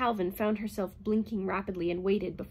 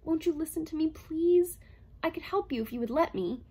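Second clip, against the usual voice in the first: lightly voiced pleading robot, higher; 8 semitones higher, 3 dB louder than usual. A narrator's reading. Herbie pleading with Calvin, a hint of higher robot voice. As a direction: beg her gently. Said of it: "He shook his head pleadingly".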